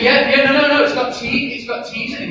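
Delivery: imitating Canadians objecting, high and loud; imitating Canadian protest. Preacher mimicking Canadians correcting his T's, mock-loud. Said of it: "And you say".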